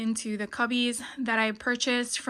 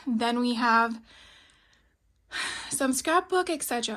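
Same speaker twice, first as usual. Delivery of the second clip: breathless, catching her breath; winded between words. Real, not acted. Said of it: "I'm sorry I'm out of breath".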